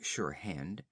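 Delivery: ordinary delivery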